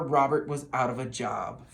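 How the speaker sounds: ordinary delivery, nothing marked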